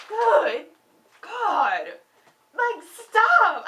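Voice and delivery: can't take how good it is; overwhelmed cry. Real, not acted. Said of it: "I'm mad because I don't like her".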